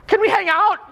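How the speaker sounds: high mock-eager ask to hang out; eager hangout plea. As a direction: squeak it out like a smitten fan. Mock eager attractive stranger.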